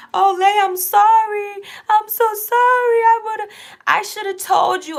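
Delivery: high, slow, whiny fake-sorry voice; mock sobbing apology. Mocking the friend who apologizes on her birthday, high, slow and whiny.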